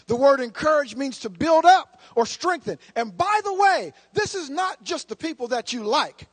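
loud, high, emphatic pulpit shout; preaching shout. Acted. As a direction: drive the point home to the congregation.

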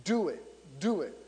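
understated, falling command; firm motherly order. Retold or imitated. Mother instructing the servants, quietly insistent. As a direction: repeat it as a calm directive. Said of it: "Notice how she says it".

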